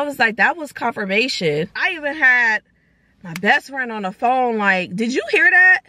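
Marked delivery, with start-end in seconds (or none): 4.9-5.8 친구에게 흥분해서 묻기; higher, louder, thrilled ask; excited question. Retold or imitated. Excitedly asks friend on phone.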